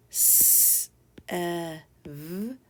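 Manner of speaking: "s, e, v" as three separate held speech sounds; sounded out. Shown as a demonstration. Teacher helps learners spell seven, "s, e, v" sounded out singly. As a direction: sound out "s, e, v" phoneme by phoneme. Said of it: "the sounds we hear".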